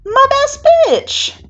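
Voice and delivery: very high, loud, drawn-out squeal. A narrator's reading. Sister greeting brother at her studio, squealing. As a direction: shriek a delighted greeting.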